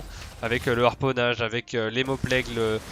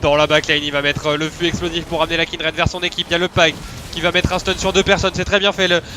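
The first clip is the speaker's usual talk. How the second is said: loud excited play-by-play; esports caster hype; 6 semitones higher, 8 dB louder than usual. Acted. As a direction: call the fight with rising hype. (commentateur esport enflammé)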